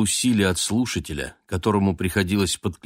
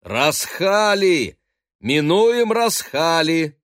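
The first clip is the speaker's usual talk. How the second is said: high, slow calling out; lookout's shout; 9 semitones higher, 4 dB louder, much slower than usual. Acted. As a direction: call it out from the masthead. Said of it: "раздался крик дозорного".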